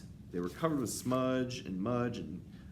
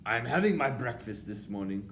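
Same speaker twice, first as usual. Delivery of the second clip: loud, haughty witch complaint; indignant cry; 3 semitones higher, 7 dB louder than usual. A narrator's reading. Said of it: "cried the Grand High Witch".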